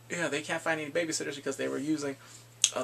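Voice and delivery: ordinary delivery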